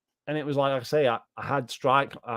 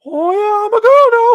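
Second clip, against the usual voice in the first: cartoonish high-pitched mockery of a guy claiming he's a girl; mocking squeaky voice; 14 dB louder than usual. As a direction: ham up a squeaky Mickey Mouse girl voice. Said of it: "That was literally how he talked".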